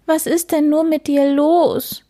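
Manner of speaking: hare's high, half-sobbing question; mock sob. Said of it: "schluchzte der Hase".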